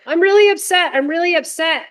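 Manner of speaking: put-on high, whiny child voice; imitating an upset kid. Therapist voicing a child who is upset, high and pleading.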